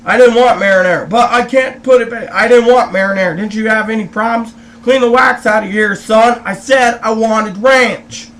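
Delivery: loud, retold restaurant complaint; indignant, loud rebuke, landing hard on the end; irate customer. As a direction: tell off the server, raising your voice. Acted quarrel over wrong sauce.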